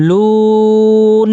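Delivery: sustained chanted "লুন"; three-count elongation. Shown as a demonstration. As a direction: stretch the oo of "লুন" on one note before stopping. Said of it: "এই জায়গায় ওয়াকফ করলে কি হবে?".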